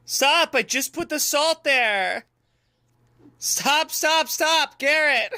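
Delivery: high, frantic protest; exasperated yelling. Pretend. Boss yells at helper Garrett over salt, exasperated.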